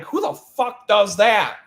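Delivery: slow, indignant question; incredulous outrage. Retold or imitated. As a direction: demand it, baffled and annoyed. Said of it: "And I'm like".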